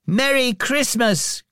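high, drawn-out festive greeting; family chorus. A narrator's reading. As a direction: chime in like cheering children, pitched well up. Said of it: "chorused the family".

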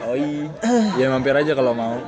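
ordinary delivery

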